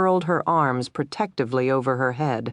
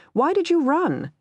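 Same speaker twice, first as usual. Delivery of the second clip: retorting question; 13 semitones higher than usual. A narrator's reading. He fires question back.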